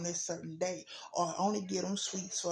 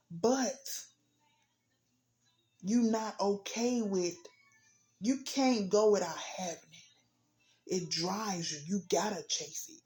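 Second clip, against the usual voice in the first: preaching at full force; 3 semitones higher than usual. Acted. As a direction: preach it hard to the congregation.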